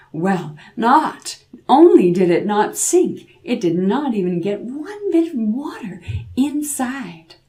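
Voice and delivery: plain reading voice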